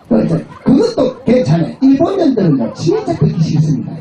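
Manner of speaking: usual speaking voice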